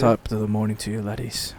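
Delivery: low, quiet, defeated take on the Irish catchphrase; deflated Irish greeting. Retold or imitated. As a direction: mutter the greeting like the energy has drained out. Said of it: "loses his accent".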